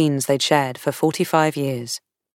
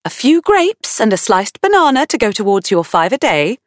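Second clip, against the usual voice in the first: high, lilting wifely reminder; Sandy; 6 semitones higher, 3 dB louder than usual. A narrator's reading. Late wife reminding her husband about fruit, high and chivvying. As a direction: chivvy a husband in a raised, sing-out voice. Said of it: "Sandy's voice rang in his ears".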